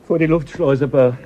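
loud, high commanding shout; shouted orders. Acted. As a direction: bark it across the deck. Jelinek barks orders at crew.

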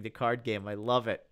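usual speaking voice

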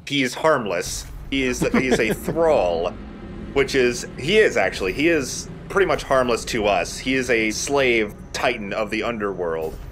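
ordinary delivery

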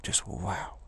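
soft, breathy whispered awe; hushed wonder, barely voiced; whispered wow. Shown as a demonstration. Teacher showing students how to meet shock, in a soft awed whisper. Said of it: "smile and just say".

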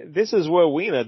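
ROWENA as "Wowena", R turned to W. Acted. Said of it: "you do the robot voice".

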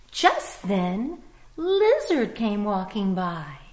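put-on bright, lively read-aloud to children; storybook narration. A narrator's reading. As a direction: tell it like a picture book for little ones.

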